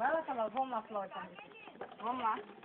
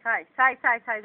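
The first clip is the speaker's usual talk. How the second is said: loud shooing; 2 semitones lower, 20 dB louder than usual. Real, not acted. Shooing away.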